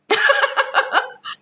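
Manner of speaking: a high burst of laughter. Real, not acted. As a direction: laugh at the irony. Laughing at own complaint. (tawa tinggi)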